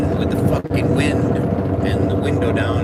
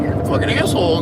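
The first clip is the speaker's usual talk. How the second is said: mock girlfriend; 10 semitones higher than usual. Mimics girlfriend yelling at him.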